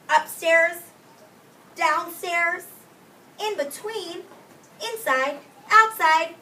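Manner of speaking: high, loud, bouncing list of places; excited storytelling. A narrator's reading. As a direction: build the wonder with every place.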